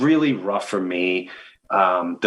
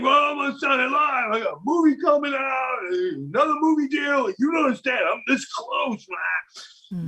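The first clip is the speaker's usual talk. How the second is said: high, pressed insisting, slowing at the end; defensive insisting; 13 semitones higher than usual. Retold or imitated. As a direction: justify yourself to your wife. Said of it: "you're like".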